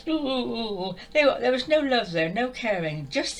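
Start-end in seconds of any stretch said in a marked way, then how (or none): 0.1-1.0 mock traffic warden. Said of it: "I always use traffic wardens".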